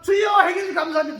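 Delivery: fast, loud shout of thanks, a bit hammed-up; mock the believer's loud thanks; mock loud prayer. Mimicking a believer who prays loudly then worries, quick mock shout. Preacher mimics congregant praying.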